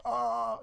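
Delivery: soft, high, drawn-out mock moan; imitating a helpless, overwhelmed "ohh"; dazed "oh". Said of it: "I'm like".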